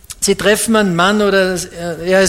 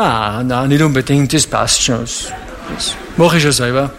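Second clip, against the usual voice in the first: lower, mumbled mock dodge; evasive mumble; 6 semitones lower than usual. Preacher voices a young man dodging God's voice, lower evasive mock mumble. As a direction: wriggle out of the question, lower.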